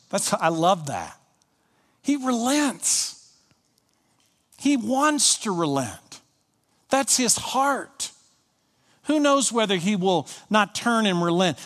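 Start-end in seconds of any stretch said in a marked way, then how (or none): 2.0-8.1 higher, slow, marveling delivery; lifted, savoring. Real, not acted. Preacher marveling at God's mercy to the flock, slow and lifted. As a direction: linger on it with wonder.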